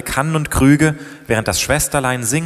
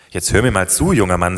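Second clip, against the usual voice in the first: usual speaking voice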